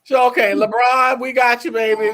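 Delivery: loud, high cheer for LeBron. Real, not acted. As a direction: hype him up.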